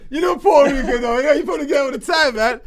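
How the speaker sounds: high, loud imitation of the locals; mock loud locals. Friend loudly imitating the locals at the bar. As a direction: shout it like the excited bar guys. Mimics Puerto Rican men.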